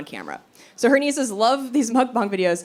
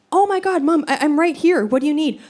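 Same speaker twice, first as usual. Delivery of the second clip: alarmed brother; 7 semitones higher, 3 dB louder than usual. Retold or imitated. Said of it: "my brother's like".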